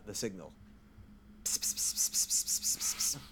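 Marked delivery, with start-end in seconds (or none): 1.4-3.2 rapid whispered psst pings like a tracker; a tracker beacon pinging closer; unvoiced beacon pings. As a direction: hiss out the signal beeps, softer. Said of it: "I'm gonna amplify the signal".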